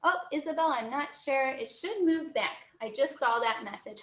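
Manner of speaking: normal talking voice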